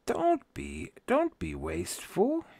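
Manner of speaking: high, slow, soft chiding voice; lightly voiced doting scold; mock scolding. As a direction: wag a finger in a high, drawn-out voice. Scolds himself about money.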